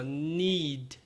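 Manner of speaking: "Need" with a long EE and only a faint D; soft final D. Shown as a demonstration. Teacher showing students the weak D in "Need". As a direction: let the D of "Need" barely sound. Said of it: "o D é bem fraquinho".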